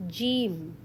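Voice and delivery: Jeem said like English J, low and soft; slow, clear Jeem; Arabic jeem. Shown as a demonstration. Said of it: "How to read it?".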